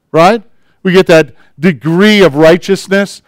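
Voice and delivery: normal talking voice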